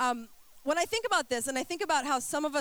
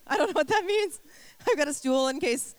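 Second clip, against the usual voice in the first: laughing, higher, louder; 4 semitones higher, 4 dB louder than usual. Real, not acted. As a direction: giggle through the words. Laughing at own cold-medicine joke.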